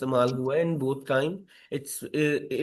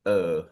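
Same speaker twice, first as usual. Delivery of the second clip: deep belched अ; burp-like अ; 10 semitones lower than usual. Pretend. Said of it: "मुझे डकार आ गया". (डकार जैसा अ)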